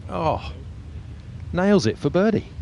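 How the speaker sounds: big rising call on the birdie putt; excited call. Real, not acted. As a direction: lift the voice with delight as it drops. Commentator reacts to holed putt.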